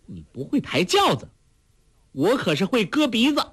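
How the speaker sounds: high, menacing rebuke; commanding threat. A narrator's reading. Heroine threatens the fat lady with her blade, sharp rebuke. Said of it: "喝道".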